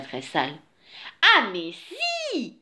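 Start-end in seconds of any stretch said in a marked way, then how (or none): 1.4-2.6 high, drawn-out storybook cry; exclaimed insistence. A narrator's reading. She-bear insists to the dirty bear, exclaiming. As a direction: stretch out the retort in a high voice. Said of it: "s'écria la jolie ours toute propre".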